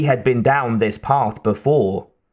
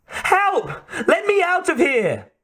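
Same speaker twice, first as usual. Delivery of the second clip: very high-pitched pleas; crying out. A narrator's reading. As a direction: cry high to be let out. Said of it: "Kate cried".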